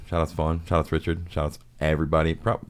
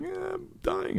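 put-on dying kid voice; 9 dB quieter than usual. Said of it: "And the kid's like".

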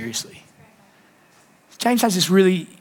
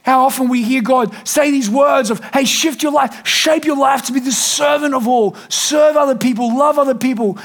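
full-force preaching; 6 semitones higher than usual. Real, not acted. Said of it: "preaching at full force".

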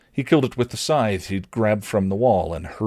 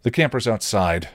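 plain reading voice

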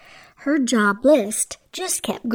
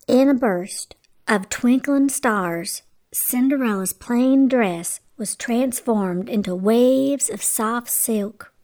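plain reading voice

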